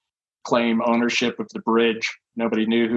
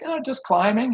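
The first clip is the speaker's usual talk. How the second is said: higher, breezy innocent reply; 13 semitones higher than usual. Retold or imitated. Climber answers the negotiator from the trestle, higher breezy innocence. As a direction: answer like nothing's wrong, pitched up. Speaker's own reply, retold.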